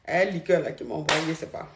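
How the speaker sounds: weary, hungover mumble; tired voice after a night of drinking. Real, not acted. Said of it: "J'ai la gueule de bois".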